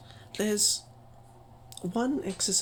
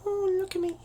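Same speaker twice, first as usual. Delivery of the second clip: exaggerated falsetto-like high voice; mock over-high feminine voice; pitched too high; 12 semitones higher than usual. Shown as a demonstration. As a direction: push the pitch way up, unnaturally. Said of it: "They go up here and they talk like this".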